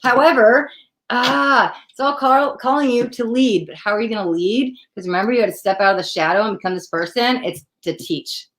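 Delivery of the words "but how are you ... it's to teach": ordinary delivery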